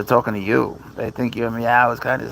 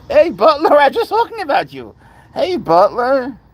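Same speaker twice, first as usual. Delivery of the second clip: much higher and louder, squealing at the cat; excited pet talk; 8 dB louder than usual. Real, not acted. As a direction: greet the cat with delight. Greeting her cat Butler.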